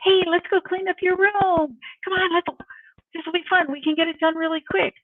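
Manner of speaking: put-on high, upbeat mom voice for little kids; coaxing small children. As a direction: cajole young kids with a high, playful lilt. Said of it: "when they were five or six".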